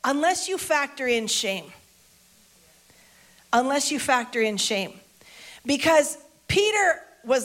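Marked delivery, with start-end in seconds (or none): none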